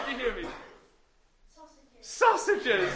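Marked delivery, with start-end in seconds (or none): 1.5-2.0 near-silent whisper turned away; whisper facing the wall. Shown as a demonstration. Turned away from the children for the hardest test: whisper. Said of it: "make it really hard". 2.0-2.9 higher, louder, laughing repeat; laughing exclaim. Real, not acted. Astonished the children heard the whisper: laughing exclaim.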